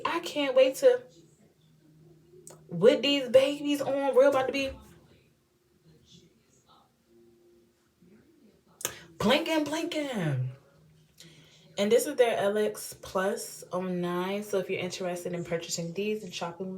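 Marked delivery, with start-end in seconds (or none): 0.0-10.5 excited. Real, not acted. Excited about the lashes.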